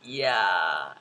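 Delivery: low, drawn-out reluctant reply; awkward admission. Retold or imitated. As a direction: act out a sheepish yes. Retold awkward admission to ajumma.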